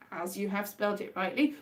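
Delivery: ordinary delivery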